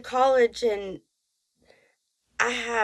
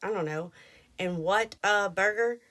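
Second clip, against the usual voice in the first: usual speaking voice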